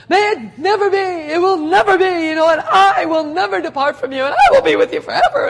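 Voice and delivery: loud, high, overeager vow of loyalty; boastful Peter. Retold or imitated. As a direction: ham up Peter's rash promise. Preacher acts out boastful Peter.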